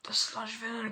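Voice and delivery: hushed, whispered realisation; inner thought. Acted. Deku thinking to himself about the villain, hushed. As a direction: whisper it to yourself. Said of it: "thinking this in his head".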